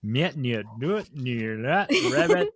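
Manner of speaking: slow, put-on Twin Peaks backwards talk; Red Room language. Shown as a demonstration. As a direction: drawl it out like the Red Room man. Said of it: "Red Room language".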